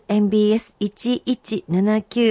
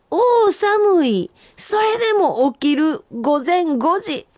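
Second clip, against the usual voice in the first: high, slow reading with a shiver in "おー、寒い"; 寒さに震えながら川柳を読む; shivering senryu recital; 8 semitones higher, slower than usual. Acted. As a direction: act out the cold morning as you recite. Reading senryu, acting cold.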